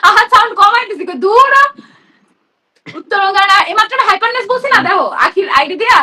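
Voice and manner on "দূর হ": high, loud snap of "get lost"; shouted dismissal. Retold or imitated. Retelling her irritation, a loud high "get lost". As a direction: bark it out to shoo someone off.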